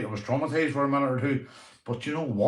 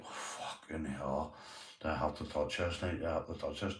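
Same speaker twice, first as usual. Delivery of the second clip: muttered dread; 6 semitones lower, 12 dB quieter than usual. Retold or imitated. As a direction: mutter to yourself, dreading the spider. Dad dreading touching the spider.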